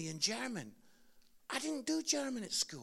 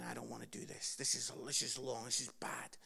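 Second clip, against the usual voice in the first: scared quiet thoughts; 10 semitones lower, 6 dB quieter than usual. Retold or imitated. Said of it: "I'm thinking".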